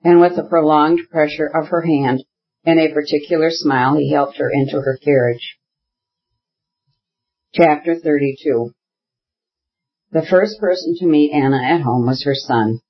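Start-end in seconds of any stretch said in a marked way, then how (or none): none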